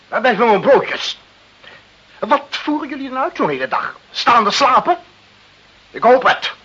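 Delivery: high, loud barking down the phone at the café; furious boss shouting into the phone; angry phone scolding. Acted. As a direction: berate the café staff for the late coffee. Director scolding café over phone.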